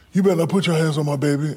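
mama warning on the phone; scolding mother. Retold or imitated. Barkley's mother voiced on phone.

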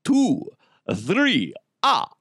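counting like the Count, with a cackling "ah, ah, ah"; Transylvanian accent. Acted. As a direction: Count von Count voice, hitting the numbers. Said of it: "get the Count voice from Sesame Street".

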